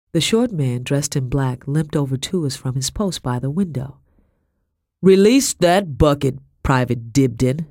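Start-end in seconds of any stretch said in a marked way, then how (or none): 5.0-7.7 loud, firm command; order. Acted. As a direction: order him like his sergeant. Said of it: "he ordered".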